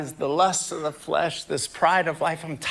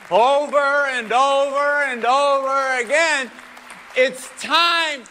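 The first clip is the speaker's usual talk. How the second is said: rising, louder preacher build, pitch climbing high; preaching at full force; 7 semitones higher, 3 dB louder than usual. Acted. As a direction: drive it home like a revival preacher. Preaching climax.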